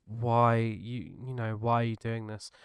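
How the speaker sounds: ordinary talk, nothing marked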